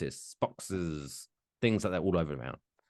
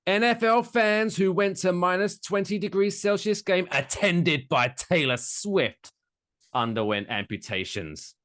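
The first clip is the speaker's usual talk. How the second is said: headline read; 10 semitones higher, 3 dB louder than usual. Acted. Host reads the absurd headline to co-host, announcer style. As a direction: announce it high and loud like a news headline.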